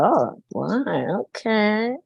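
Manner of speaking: drawn-out, swooping mock amazement; retold pleasant surprise, pitch gliding up and down; impressed reaction. Mother reacting to son's "yes, ma'am", impressed.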